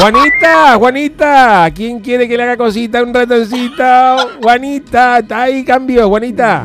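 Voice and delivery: over walkie talkie. Acted. Flirty walkie-talkie call.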